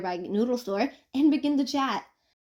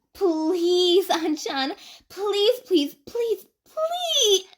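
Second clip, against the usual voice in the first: high, rising, whiny begging; pleading; 9 semitones higher, 5 dB louder, much slower than usual. A narrator's reading. Mizuki begging An to let them stay, climbing whine. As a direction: beg harder with every please.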